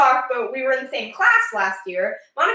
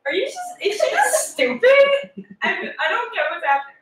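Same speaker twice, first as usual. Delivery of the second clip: laughing disbelief; 10 semitones higher than usual. Real, not acted. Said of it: "laughing disbelief".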